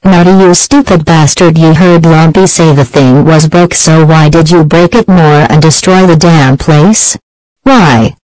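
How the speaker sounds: angry yelling parent. Acted. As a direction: yell at the kid for wrecking it. Parent scolding Nutty after destruction.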